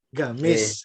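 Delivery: "Gamis." said with a hard g in place of the Arabic q; Saudi g for qaf. Shown as a demonstration. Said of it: "bilangnya ga kan". ("Gamis." diucapkan dengan g seperti orang Saudi)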